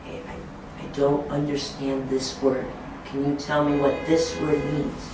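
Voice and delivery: softly, as if into the teacher's ear; a discreet, hushed request; quiet aside. Shown as a demonstration. Assistant quietly asking the teacher for help, softly. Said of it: "and quietly say to me, maybe in my ear".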